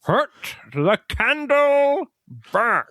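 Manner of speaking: high, very slow strained plea; squashed Wilder. Retold or imitated. Wilder stuck in the bookcase, pleading high and drawn out. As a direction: squeeze it out, face pressed against the wall.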